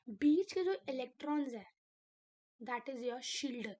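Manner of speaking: ordinary talk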